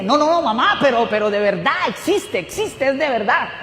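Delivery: almost shouting it, desperate to convince her; excited insistence. Retold or imitated. Son tells mother God exists.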